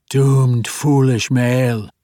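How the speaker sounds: gurgle it like popping mud bubbles; gloopy bubbling slime voice. A narrator's reading. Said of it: "in a gurgling voice that sounded like the popping bubbles".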